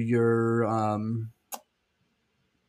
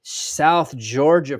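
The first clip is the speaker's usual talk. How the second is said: ordinary delivery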